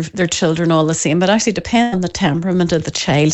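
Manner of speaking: ordinary delivery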